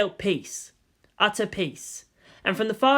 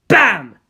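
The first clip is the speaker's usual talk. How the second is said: sudden loud bam; loud crash; 2 semitones higher, 11 dB louder than usual. A narrator's reading. Narrator punches the crash into the cliff loudly. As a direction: slam the word like an impact.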